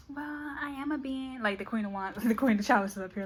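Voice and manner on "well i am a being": soft high-voiced lady; prim, quiet retort; lightly voiced proud woman; woman's reply. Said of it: "she's like".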